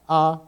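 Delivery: ah held level and straight; first tone. Shown as a demonstration. Teacher showing Mandarin first tone, level ah. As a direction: sing ah on one flat steady pitch. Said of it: "First tone is straight across".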